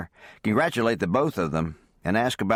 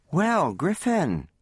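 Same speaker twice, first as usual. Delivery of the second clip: high, slow, delighted greeting; thrilled and startled to see him; surprised delight; 9 semitones higher, much slower than usual. A narrator's reading. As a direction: Tess delighted as Griffin walks into the kitchen. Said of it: "in surprised delight".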